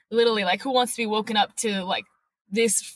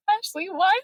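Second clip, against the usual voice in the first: high, frantic questions in rising panic; panicked disbelief; 6 semitones higher than usual. Retold or imitated. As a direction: beg for an answer, voice climbing. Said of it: "And I'm like".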